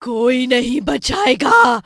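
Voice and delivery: slow, loud menacing witch; evil witch taunting the boy. Acted. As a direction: gloat like a wicked witch. Witch gloats over captive boy.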